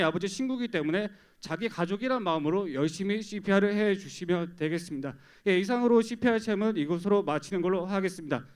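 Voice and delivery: usual speaking voice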